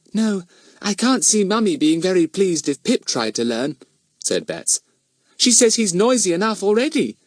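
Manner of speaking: put-on high chatty little girl; lightly voiced chatty girl; young girl voice. A narrator's reading. Betts voiced.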